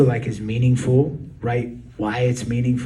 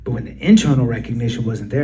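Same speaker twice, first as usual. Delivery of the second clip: normal talking voice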